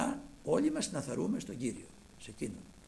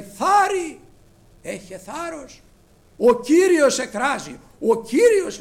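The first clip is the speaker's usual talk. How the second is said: loud, high rallying call; calling out encouragement; 9 semitones higher, 14 dB louder, slower than usual. Retold or imitated. Crowd telling Bartimaeus the Lord calls him, loud urging. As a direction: urge him up with a shout. People calling the blind man over.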